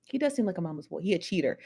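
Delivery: ordinary delivery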